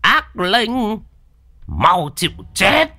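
high, ringing exorcist's cry; high, drawn-out threatening yell; shouted command. A narrator's reading. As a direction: order the evil spirit to die. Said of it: "Lão quát".

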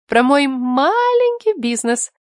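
slow, defiant boast. A narrator's reading. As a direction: stretch it out slowly with a note of challenge. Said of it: "с вызовом".

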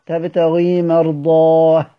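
slow recitation demo of مرضاه; stopping with ha. Shown as a demonstration. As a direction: stop on مرضاه with a breathy final h. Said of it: "لو وقف عليها مرضات".

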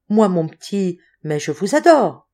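plain reading voice

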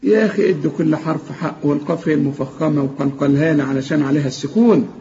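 ordinary delivery

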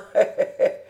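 short tipsy chuckle. A narrator's reading. Old farmer amused at losing his way, short chuckle. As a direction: chuckle at your own tipsiness.

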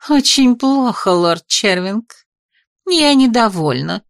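slow, haughty displeasure; drawn-out, spoiled annoyance; irritated lady. Acted. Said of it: "в раздражении передёрнула хрупкими плечами". (раздражённо и надменно)

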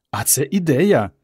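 ordinary delivery, nothing marked

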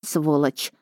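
low-key, careful echo; guarded and subdued; cautious agreement. A narrator's reading. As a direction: agree carefully, holding back. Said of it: "согласилась я осторожно".

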